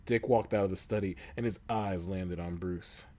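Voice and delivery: ordinary talk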